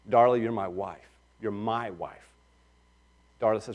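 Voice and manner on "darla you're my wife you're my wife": soft, low, tender words to a wife; affectionate. Retold or imitated. Husband telling his wife Darla she is his, softly affectionate. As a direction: say it lovingly and quietly. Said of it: "In an affectionate way".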